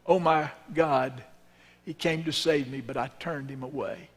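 condemned man's dying words on the gallows, fading out; last words. Acted. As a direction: let the words die away as the final breath.

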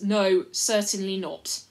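loud, slow firm no; slow, loud emphatic refusal. Acted. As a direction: shut him down firmly. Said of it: "said Caspian and Edmund".

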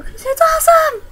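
very high, loud squeal; gushing retort. Retold or imitated. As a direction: squeal it as an over-excited comeback. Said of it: "and I go".

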